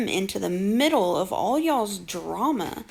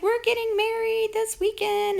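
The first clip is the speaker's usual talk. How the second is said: mocking quote; 10 semitones higher than usual. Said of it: "she's like".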